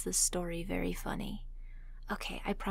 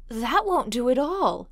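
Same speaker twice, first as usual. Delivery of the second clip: sharp, loud and higher protest; irritable; 5 semitones higher, 7 dB louder than usual. A narrator's reading. McFadden rejecting the shark death, irritably. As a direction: snap it out in irritation. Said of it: "he said irritably".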